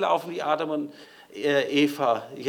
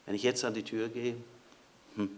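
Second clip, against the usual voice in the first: ordinary talk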